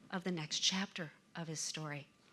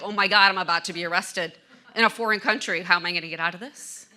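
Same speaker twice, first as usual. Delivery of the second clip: louder, rushed mock panic; retold panic; 11 dB louder, faster than usual. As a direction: act out a man sure he's about to be arrested. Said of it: "he is totally freaking out".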